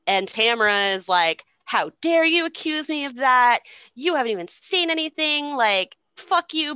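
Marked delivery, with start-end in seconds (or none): 1.6-6.7 high-pitched, hammed-up outrage; mock indignant yelling. Queen answering an accusation of an affair, mock indignant yelling. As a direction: yell back as the falsely offended party. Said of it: "as she's yelling back at them".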